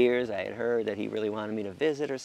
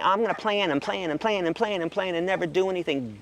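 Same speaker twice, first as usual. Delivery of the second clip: ordinary talk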